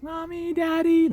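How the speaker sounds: put-on very high, sweet child voice; high childlike call; little kid calling. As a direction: peek out and call to your parents like a small child. Child calling parents from bedroom.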